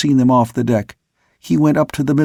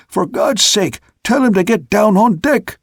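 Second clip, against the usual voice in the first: distant shout; 8 semitones higher than usual. A narrator's reading. Stubbins shouting down from the rigging, distant. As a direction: yell it from far above. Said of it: "he was shouting".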